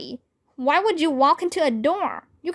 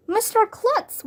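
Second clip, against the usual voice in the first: called out high, rising; calling out his name in surprise; shouted name; 9 semitones higher than usual. A narrator's reading. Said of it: "we all shouted".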